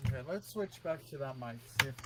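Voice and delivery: distant, off-mic voice; speaking from across the room; far from mic. Real, not acted. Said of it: "you sound like you've gone to another room".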